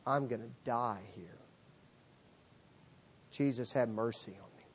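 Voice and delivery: low, soft imitated plea; quiet plea. Leper begging Jesus for mercy, quietly resigned.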